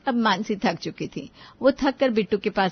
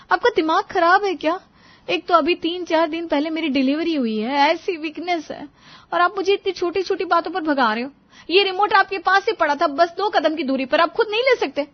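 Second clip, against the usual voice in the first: higher-pitched, exasperated complaint; indignant protest; 8 semitones higher, 4 dB louder than usual. Acted. Tired new mother rebukes husband over remote, indignant. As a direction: protest at his laziness, fed up. Wife protests after recent delivery.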